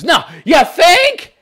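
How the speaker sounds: high, slow sarcastic shout; mock him with a loud sneer. Real, not acted. Sarcastic retort to message.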